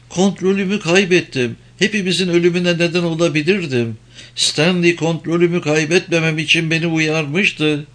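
high, urgent pleading woman. Acted. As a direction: beg him anxiously, voice up high. Karin's distressed high voice. (yalvaran, tiz kadın sesi)

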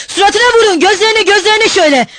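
high, loud frenzied yelling; furious shout. A narrator's reading. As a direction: goad them on at the top of your voice. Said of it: "Mikolka bağırıyordu".

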